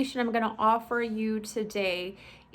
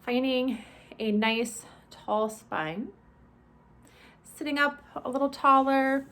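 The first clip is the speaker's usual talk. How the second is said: measured, spacious body-scan instruction; guided meditation. Acted. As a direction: ease listeners through each step, stretching the phrase endings.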